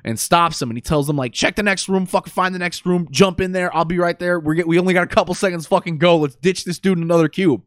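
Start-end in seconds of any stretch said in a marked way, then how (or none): 1.3-7.6 higher-pitched imitation of urgent instructions; urgent orders. Man holding the door tells companions to run, mock-urgent. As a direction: rattle off orders, imitating someone under pressure.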